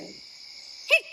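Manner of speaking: breathy high hiccup. Acted. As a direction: squeak out a hiccup. Benny hiccups again.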